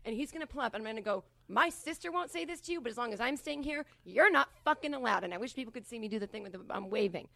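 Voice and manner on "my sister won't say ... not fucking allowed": raised pitch, building to a loud ban; rehearsed stern warning. Pretend. Protective sister barring Joe from the house, stern. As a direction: lay down the law, getting louder. Rehearsing confrontation on front steps.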